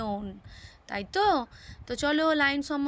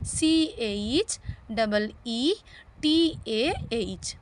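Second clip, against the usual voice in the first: slow spelling out; much slower than usual. Shown as a demonstration. Spells word slowly.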